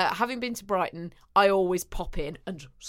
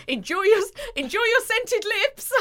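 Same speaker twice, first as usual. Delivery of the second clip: put-on high, sing-song shop girl voice; mock shop assistant; 12 semitones higher, 3 dB louder than usual. Imitating the shop assistant seeing her off, high and chirpy. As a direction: mimic the assistant's chirpy farewell. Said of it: "And she's like".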